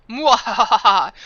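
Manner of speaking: loud hammy villain cackle; evil cackle. Acted. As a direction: let out a big panto villain laugh. Villain laugh in character.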